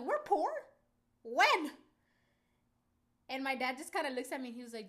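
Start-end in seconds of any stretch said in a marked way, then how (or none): 0.0-1.7 high, loud shocked questioning; acted-out stunned outrage; incredulous. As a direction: blurt it out in hammed-up disbelief. Retelling shock at dad calling them poor.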